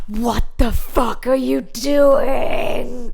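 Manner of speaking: slow, loud American shout; angry American father shouting at mum; American dad yell. Retold or imitated. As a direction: bellow it like a furious American dad. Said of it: "I'm sorry, he's American".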